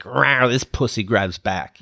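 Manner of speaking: slow, growling rawr like a cat; marchers' slogan as a playful cat growl. Retold or imitated. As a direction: claw at it with a playful roar. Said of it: "They're saying, like".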